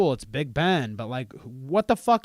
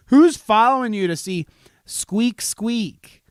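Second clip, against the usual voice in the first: outraged rant; 7 semitones higher, 9 dB louder than usual. Real, not acted. Ranting about Rubber Ducky's followers.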